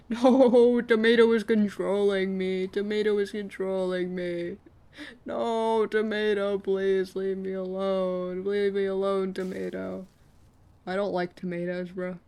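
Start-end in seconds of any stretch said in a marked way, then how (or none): 0.1-4.6 loud drawn-out wail, then fading; mock despair. Streamer claims Tomato is controlling them, mock despair. 5.2-10.1 long drawn-out plaintive begging; mock pleading. Streamer begging Tomato to leave them alone, mock pleading.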